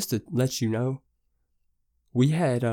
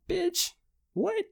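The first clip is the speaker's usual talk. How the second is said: much higher shrieked bitch what; shocked. Retold or imitated. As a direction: squeal in disbelief. Shocked at stolen car news.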